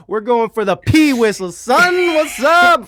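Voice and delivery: high and loud, half sung, ending in a shout; a hammed-up singsong brag; mock hype shout. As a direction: belt it like a hype man.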